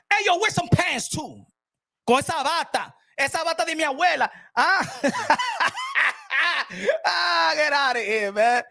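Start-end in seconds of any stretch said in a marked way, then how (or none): 5.0-7.0 laughing shout. Real, not acted. Loud high laugh.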